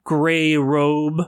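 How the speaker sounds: ordinary delivery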